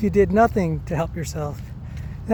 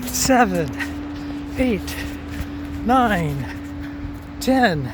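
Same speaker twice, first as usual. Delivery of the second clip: counting at half power; 3 semitones higher, much slower than usual. Shown as a demonstration. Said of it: "I'm at fifty percent power".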